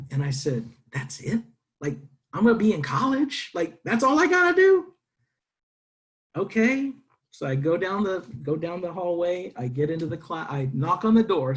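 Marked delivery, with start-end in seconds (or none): 0.9-6.9 climbing to a much higher, louder squeal of disbelief; can't believe it's this easy, voice soaring up; amazed disbelief. Retold or imitated. Amazed at how easy enrolment is.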